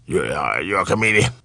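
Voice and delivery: low, soft, halting question; older man's muttered query; imitating older comedian. Acts Foxx's low questioning reply.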